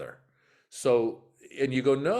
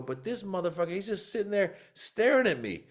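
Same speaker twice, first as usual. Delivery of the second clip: mock call-out; 10 semitones higher than usual. Calling out the stoic fan.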